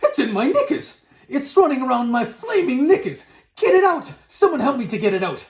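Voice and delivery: high, loud comic panic; screaming. A narrator's reading. Cook with mouse in his knickers, screaming. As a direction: wail frantically for someone to help. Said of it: "the man was screaming".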